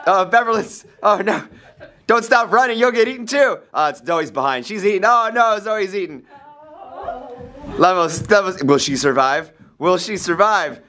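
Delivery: high, breathless panicked narration; dramatic panic. Pretend. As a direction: play up the chase terror. Dramatic chase narration continues.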